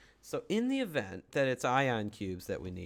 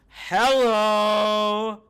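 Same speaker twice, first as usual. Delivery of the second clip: surprised; 9 semitones higher, 14 dB louder than usual. Real, not acted. Gasps on spotting alien arch.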